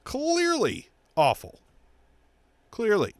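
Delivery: high, slow disbelief; exasperated. Real, not acted. Ranting to viewers, high exasperated clearly. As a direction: drag out clearly in disgust.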